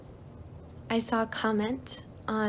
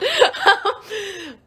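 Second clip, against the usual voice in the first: high, loud burst of laughter; 12 semitones higher, 8 dB louder than usual. Real, not acted. Streamer amused by her own Russian outburst, high laugh.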